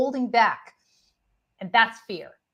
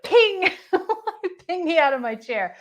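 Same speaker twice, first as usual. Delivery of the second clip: laughing; 9 semitones higher, 5 dB louder than usual. Real, not acted. Laughs while describing feeling.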